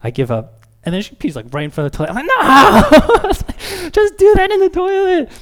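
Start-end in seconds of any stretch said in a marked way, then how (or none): 2.2-5.3 very high, loud, slow frustrated shout; exasperated yell. Retold or imitated. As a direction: yell at a toddler peeing on the floor. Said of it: "are you finished yelling at me".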